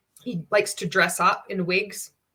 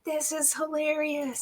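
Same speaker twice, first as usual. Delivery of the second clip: mocking; 9 semitones higher, 6 dB quieter, slower than usual. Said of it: "we're like".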